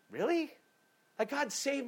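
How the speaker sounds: can't believe they'd say that; a high disbelieving really; incredulous. Real, not acted. Incredulous reply to critics.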